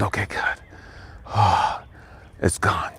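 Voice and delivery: slow, soft whisper with a sigh; retold relieved prayer, breathed out slowly; whispered relief. Acts out cautious relief in prayer.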